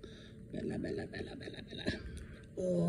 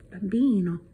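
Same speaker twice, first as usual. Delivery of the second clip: normal talking voice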